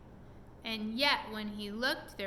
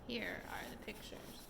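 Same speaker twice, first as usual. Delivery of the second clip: whisper; 10 dB quieter than usual. Real, not acted. Aside to child over book.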